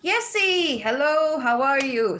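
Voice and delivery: high, bright sing-song hello to a friend; excited greeting. Real, not acted. Greeting a favourite viewer Yessie, excitedly. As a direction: call out her name happily.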